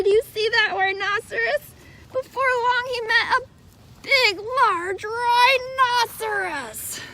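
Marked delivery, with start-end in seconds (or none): 2.1-7.0 bigger storybook reveal. A narrator's reading. Reading to a child, playing up the big rhinoceros. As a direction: build up the big rhinoceros for the kids.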